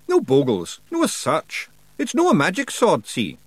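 high, broad Scots wee man, cheeky and gruff; Scottish pictsie Rob. Acted. Pictsie chief thanking nobody for thanks, in a high Scots voice.

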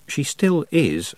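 slow, deliberate correction; pointed, slow reply. Acted. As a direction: lean on each word to set them straight. Tiffany quietly correcting.

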